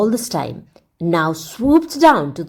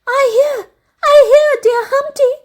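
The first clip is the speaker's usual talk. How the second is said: very high, loud, calling back with joy; a princess answering her beloved gladly; joyful cry; 6 dB louder than usual. A narrator's reading. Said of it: "cried Princess Star".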